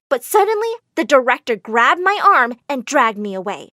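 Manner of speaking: plain reading voice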